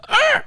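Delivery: high-pitched "arr" yelp; imitated ad-lib screech. Comedian imitating rappers recording ad-libs, high screech. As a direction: squawk out a crazy rap ad-lib. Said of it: "those ones where it's like".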